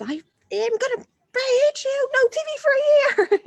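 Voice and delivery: high, loud hammed-up yell ending in a laugh; mock angry parent. Parent threatening a child with an exaggerated yell. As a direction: play the furious parent over the top, then crack up.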